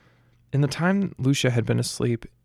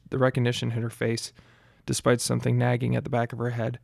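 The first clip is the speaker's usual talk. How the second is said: usual speaking voice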